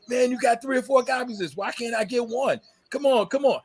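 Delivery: high, whiny begging, half sung; mock pleading buddy. Friend begging a record collector for a copy, high whiny mock plea. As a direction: wheedle for the record like an old friend.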